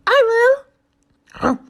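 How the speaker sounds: high loud yelp of a dog. A narrator's reading. Eager dog jumping at the bread offer, loud high yelp. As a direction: yip it out eagerly like a puppy. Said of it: "yelped the dog".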